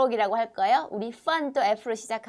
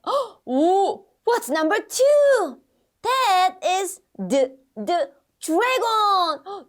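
gasping, high and loud reveal; excited amazement; 8 semitones higher, 5 dB louder, much slower than usual. Pretend. Teacher revealing a dragon card to kids, amazed. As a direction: marvel slowly at it for small children.

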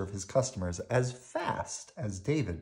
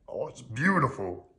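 admiring grouch voice; delighted puppet grouch; Oscar; 4 semitones higher than usual. A narrator's reading. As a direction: gush gruffly, pitch swelling up. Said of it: "he said".

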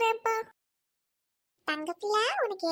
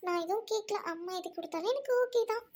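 ordinary talk, nothing marked

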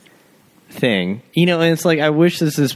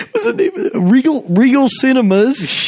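mock excited dad; 8 semitones higher than usual. Dad planning movie night.

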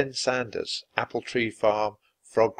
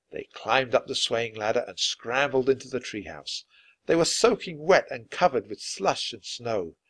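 ordinary delivery, nothing marked

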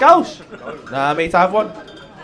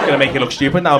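normal talking voice

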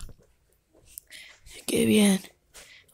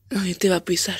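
whisper; 2 semitones lower than usual. Acted. Whispered voice held.

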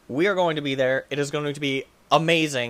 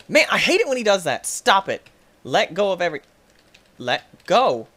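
1 frustrated yelling; 5 semitones higher, 5 dB louder than usual. Real, not acted.

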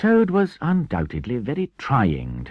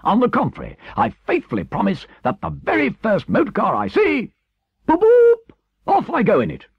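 very high, loud, excited vow; defiant, gleeful Toad; 8 dB louder than usual. Acted. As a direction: build to loud honking horn noises. Toad vows to take a car.

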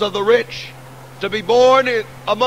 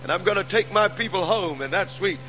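triumphant revival preacher ringing out; preaching at full force; 3 semitones higher than usual. Acted. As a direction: build the hall to a shout of hope. Triumphant resurrection climax.

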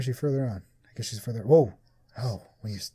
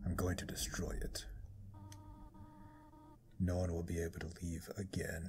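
demonic whisper; 6 semitones lower, 15 dB quieter than usual. Acted. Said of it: "I'm giving her a demonic voice".

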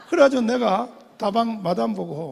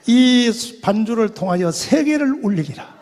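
louder, proclaiming the prophecy; prophetic proclamation; 8 dB louder than usual. Retold or imitated. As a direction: declare it like a prophet. Quoting prophetic pastor. (외치듯 선포하는 예언)

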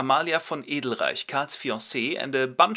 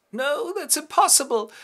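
far higher, louder cry of disbelief; shocked denial; 6 dB louder than usual. Acted. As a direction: refuse to believe the news. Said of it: "Amalia goes".

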